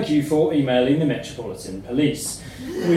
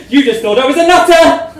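gleeful laugh; 10 semitones higher, 10 dB louder, faster than usual. Real, not acted.

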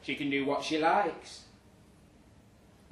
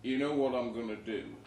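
smug rival; 3 semitones lower than usual. Retold or imitated. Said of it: "said Jolene".

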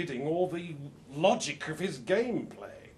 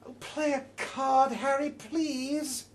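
higher, slow, pleading; exasperated Yvonne; 9 semitones higher, slower than usual. Acted. Yvonne begging Harry to play his card, exasperated and slow. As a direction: drag out the plea, fed up. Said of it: "says Yvonne".

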